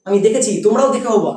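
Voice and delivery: ordinary talk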